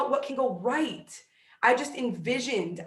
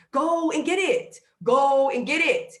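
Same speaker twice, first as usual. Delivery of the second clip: slow, emphatic and loud; forceful urging; 4 semitones higher, 4 dB louder than usual. Real, not acted. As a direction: push them to go get it. Urging listeners to take action.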